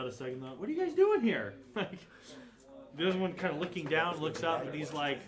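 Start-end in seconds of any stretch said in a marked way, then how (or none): 0.6-1.5 high, loud mock questioning; mimicking a player. Game master echoing player's question, mock high voice. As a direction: echo it high and loud, playfully.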